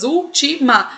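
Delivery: "Última" split into úl, ti, ma; syllable by syllable. Shown as a demonstration. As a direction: break "Última" into its three syllables. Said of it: "temos três sílabas".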